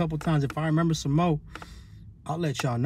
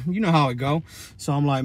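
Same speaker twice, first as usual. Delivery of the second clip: usual speaking voice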